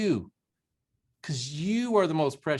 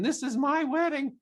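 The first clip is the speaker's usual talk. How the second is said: nervous realization; 9 semitones higher than usual. Retold or imitated. Retold nervous realization at wedding.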